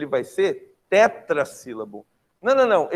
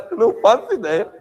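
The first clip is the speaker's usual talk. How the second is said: louder, higher, bursting out after a laugh; amused admission; 8 semitones higher, 7 dB louder than usual. Real, not acted. Teacher caught out by a student's question, loud amused admission. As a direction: admit it with a laugh, loud and high.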